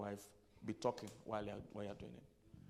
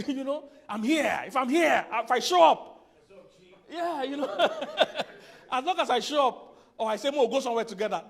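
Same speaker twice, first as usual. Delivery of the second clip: much higher, louder, laughing through it; squeaky loud laughing imitation of men; laughing mock guy voice; 12 semitones higher, 17 dB louder than usual. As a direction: ham up the clueless husband. Mocking how men show love.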